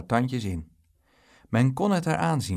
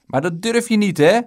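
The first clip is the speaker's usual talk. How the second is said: loud, high goading challenge; raised, defiant boyish yell; shouted dare; 9 semitones higher, 10 dB louder than usual. A narrator's reading. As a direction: goad him at high volume. Dick dares the bully.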